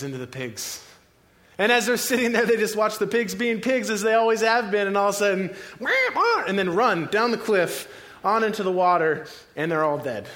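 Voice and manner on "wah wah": high imitated pig squeals. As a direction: squeal like panicked pigs. Imitating pigs suddenly squealing.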